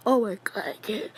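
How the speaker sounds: yawning mid-sentence. Real, not acted. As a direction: talk through a yawn. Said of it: "I just yawned".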